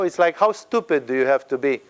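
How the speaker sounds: ordinary delivery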